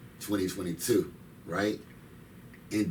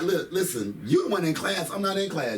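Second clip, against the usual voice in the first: higher, louder exasperated scolding of his daughter over homework; a half-laughing, exasperated lecture on paying attention; frustrated parent; 8 semitones higher, 4 dB louder than usual. Retold or imitated. Dad to daughter over new math homework, frustrated and raised. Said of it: "But I'm getting frustrated".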